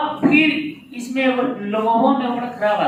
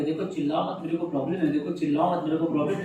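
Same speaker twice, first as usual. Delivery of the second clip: lower, softer pleading; hushed, weary request to stop yelling; 8 semitones lower, 9 dB quieter, faster than usual. Retold or imitated. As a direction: quietly beg them to stop shouting. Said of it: "कह रहा है बार बार के".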